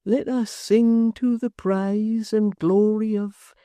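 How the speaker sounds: slow, absent sing-song, as if by rote; a dazed man reciting without thinking; mechanical muttering. A narrator's reading. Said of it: "muttered, as it were mechanically".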